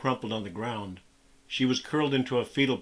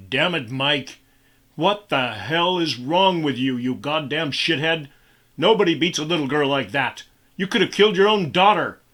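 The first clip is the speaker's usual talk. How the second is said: louder, furious roar at the boss; menacing growl; 3 semitones higher, 7 dB louder than usual. A narrator's reading. Man confronting his boss over the girl, angry growl. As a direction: threaten him with fury. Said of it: "he growled in a low, menacing voice".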